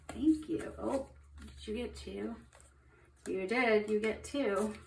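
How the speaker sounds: usual speaking voice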